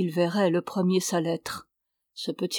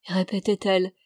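plain reading voice

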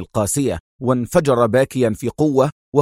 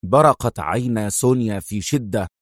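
plain reading voice

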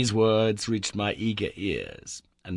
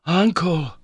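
calling out; 9 semitones higher, 6 dB louder than usual. Acted. Calling through the wall.

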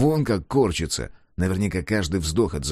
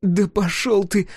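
much higher, louder, slow defiance; defiant girl; 12 semitones higher, 4 dB louder, slower than usual. A narrator's reading. The captive girl tells Scar to get lost. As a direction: spit the words out at him.

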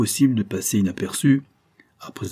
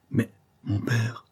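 slow, soft panting plea; 2 semitones higher, 4 dB quieter, slower than usual. A narrator's reading. Robert protesting to the adventuress, panting. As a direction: gasp it out, out of breath. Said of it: "haletait Robert".

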